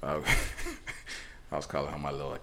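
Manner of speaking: ordinary talk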